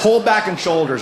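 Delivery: ordinary talk, nothing marked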